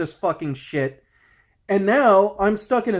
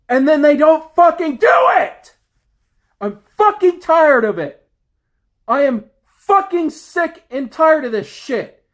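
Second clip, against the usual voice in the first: high, loud, furious yelling; blowing up at lazy coworkers; furious shout; 7 semitones higher, 6 dB louder than usual. Real, not acted. Ranting to viewers about coworkers, furious shouting. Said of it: "angry as hell over this shit".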